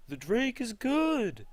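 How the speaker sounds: stupid high-pitched cartoon voice, loud and stretched out; rip-off B-movie voice, pushed very high; Jerry Seinfeld. Jerry coming out of the bathroom, silly high voice. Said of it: "do a stupid voice for Jerry Seinfeld".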